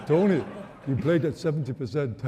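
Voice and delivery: bad French impression; French accent. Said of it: "It's a bad impression".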